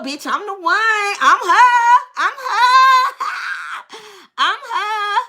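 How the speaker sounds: high, rising boast with a laugh; hammed-up squeal of being the chosen one; gloating delight. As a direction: crow it triumphantly to a friend. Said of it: "You on the phone, you listening".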